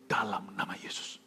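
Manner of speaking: slow, low near-whisper; very soft, drawn-out whispered invocation; hushed invocation. Retold or imitated. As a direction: breathe it out as a secret prayer. Retold prayer facing the gunman.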